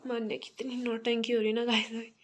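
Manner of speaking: ordinary talk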